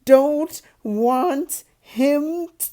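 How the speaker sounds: hammed-up pretend crying; fake crying yell. A narrator's reading. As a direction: wail to get his way. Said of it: "Dudley yelled between huge pretend gasps".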